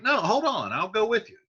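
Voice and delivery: high, eager pleading voice; eager paraphrase. Retold or imitated. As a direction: hurry after your friend. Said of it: "Benvolio's like".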